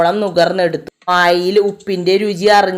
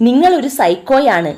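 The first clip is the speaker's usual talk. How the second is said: angry accusation; 6 semitones higher than usual. Acted. Jani accuses Mayur after assault.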